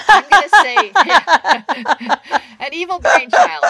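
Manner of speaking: loud, laughing through the words. Real, not acted. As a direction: crack up while saying it. Joking about evil brain child.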